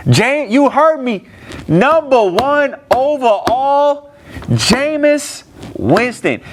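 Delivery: slow, high drawn-out announcement; mock solemn. Real, not acted. To the friend watching, slow high mocking announcement. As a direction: lay out each word slowly like it's unbelievable. Mocking the number one pick.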